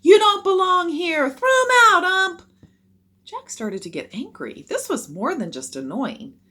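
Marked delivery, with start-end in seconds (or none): 0.0-2.4 very loud, high, mean yelling; grown-up heckler jeering at the field; harsh heckling. A narrator's reading. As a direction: yell it with hostility. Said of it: "Their voices had grown harsh".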